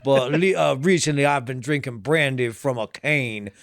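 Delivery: slow, lazy Southern drawl; a lightly done Southern gent sipping bourbon; playful Southern accent while telling a tall tale. Pretend. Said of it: "you automatically get a Southern accent".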